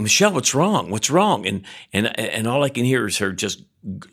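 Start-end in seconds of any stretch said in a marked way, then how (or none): 0.0-1.5 higher-pitched acted concern; retold worried call. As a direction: call out, re-enacting worry for your wife. Calls to groaning wife at night.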